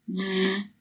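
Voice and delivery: z with tongue between the teeth, voiced; voiced TH sound. Shown as a demonstration. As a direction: hold a soft buzzing TH. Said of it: "根据正确的发音步骤来多多进行练习".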